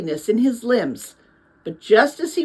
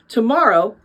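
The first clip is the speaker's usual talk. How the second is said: plain reading voice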